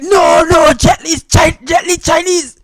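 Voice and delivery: high, loud protest, correcting him; no, no, he's Chinese, loud and high; exasperated shout. Retold or imitated. Japanese friend corrects him, exasperated shout.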